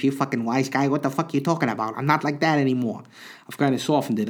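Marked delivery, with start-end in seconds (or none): 0.0-3.0 put-on New York mobster accent, fast and tough; Mafia voice. Mocks his old Mafia voice to listeners, New York mobster accent. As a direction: ham up a New York wise guy accent. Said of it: "doing the Mafia voice".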